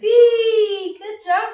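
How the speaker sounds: high, loud, happy letter; excited cheer. Acted. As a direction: celebrate the right answer. Teacher cheers the answer.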